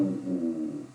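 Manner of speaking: ordinary talk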